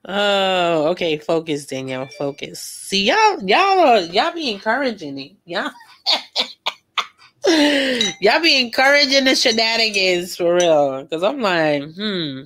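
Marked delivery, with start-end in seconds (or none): 2.6-10.4 high playful scold of "y'all"; mock scolding. Real, not acted. Vlogger playfully scolding viewers for encouraging her.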